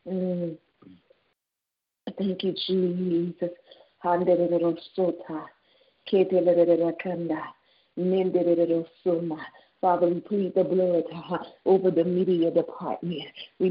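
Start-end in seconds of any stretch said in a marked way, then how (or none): 4.0-9.4 lower, rhythmic tongues prayer; speaking in tongues. Real, not acted. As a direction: pour out syllables in fervent prayer. Prayer in unknown syllables.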